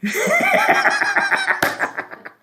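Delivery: sudden high-pitched laughter. Real, not acted. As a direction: let out a high laugh at the co-host's remark. Laughs at co-host.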